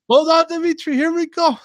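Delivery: very high, loud hammed-up Eastern European worker; mock Eastern European accent. A worker on the ladder, in a hammed-up Eastern European accent. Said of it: "people from Eastern Europe or something".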